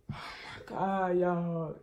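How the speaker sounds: soft, slow, shaken exhale; a quiet, worn-out oh my God; real distress. Real, not acted. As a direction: let the stress weigh on the words. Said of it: "this stressed me".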